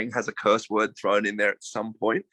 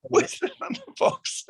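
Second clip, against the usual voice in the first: high, slow words squeezed out through laughter; cracking up while talking; laughing; slower than usual. Real, not acted. Cracking up at the slang.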